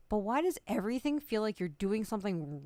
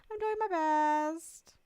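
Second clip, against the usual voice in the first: strained mock reply; 8 semitones higher, 8 dB louder, slower than usual.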